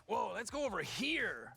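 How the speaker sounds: lightly put-on gravelly hype voice; gravelly gamer voice. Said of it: "kind of voice".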